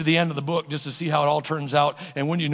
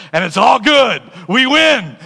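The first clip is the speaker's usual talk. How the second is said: loud, raised victory cry; triumphant; 5 semitones higher, 7 dB louder, slower than usual. Real, not acted. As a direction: proclaim the win at full force. Triumphant climax of the sermon.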